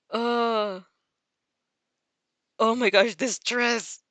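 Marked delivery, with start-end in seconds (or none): none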